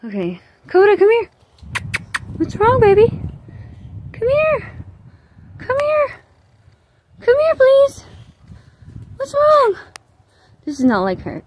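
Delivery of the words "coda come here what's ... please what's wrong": very high, loud pet-talk voice; coaxing the dog. Real, not acted. Owner begs dog Coda to come, very high coaxing call. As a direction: pitch it way up to coax a worried dog over.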